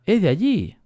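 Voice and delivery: high, lilting Argentine impression; sing-song Rioplatense Argentine accent; mock Argentine accent. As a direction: play at being Argentine, voice pitched right up. Keeps up the mock Argentine act.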